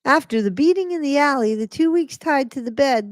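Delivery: mocking dramatic reading. Shown as a demonstration. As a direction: ham it up to mock the lies. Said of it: "Here we go for the pack of lies. You ready?".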